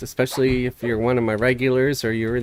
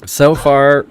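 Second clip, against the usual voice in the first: loud; 3 semitones higher, 10 dB louder than usual. Real, not acted. Announcing guild news.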